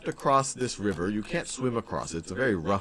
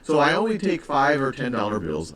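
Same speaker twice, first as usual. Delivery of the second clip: lightly voiced troll, a little louder; being the troll; 4 semitones higher, 5 dB louder than usual. Teacher playing the troll for students, lightly voiced. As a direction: brag a touch as the troll. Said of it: "I'm being the troll".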